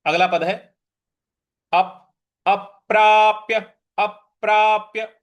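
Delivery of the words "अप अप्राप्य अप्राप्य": अप्राप्य with a punched first प; stress on प. Shown as a demonstration. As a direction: hit the प after अ in अप्राप्य. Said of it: "प है उस पर हमको बल लगाना है".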